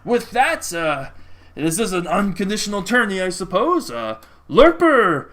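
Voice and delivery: high, loud ring announcer, drawing out the big finish; arena taskmaster. Acted. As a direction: whip up the crowd as the arena announcer.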